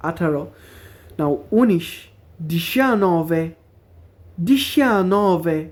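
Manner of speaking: each number spelled out slowly and clearly for learners; slow careful Italian numbers. Shown as a demonstration. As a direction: say every Italian number twice, carefully, like a teacher drilling. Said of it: "আবার পড়ে দিতেছি, যাতে উচ্চারণগুলো ভালো মতো খেয়াল থাকে".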